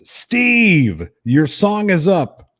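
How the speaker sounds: mock DJ announcing the next singer; DJ calling out. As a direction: call the name out like a karaoke host. DJ points, calls him up.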